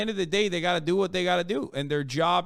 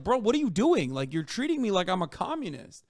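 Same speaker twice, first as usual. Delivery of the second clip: whiny, incredulous protest; hammed-up indignation, rising in pitch at the end; mock outrage; 6 semitones higher than usual. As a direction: imitate an aggrieved complainer pleading unfairness. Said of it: "losing their shit when they were like".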